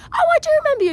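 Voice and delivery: high, loud acted squeal; excited recognition. Van owner remembering a new friend, excited squeal. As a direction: gush it in a high voice.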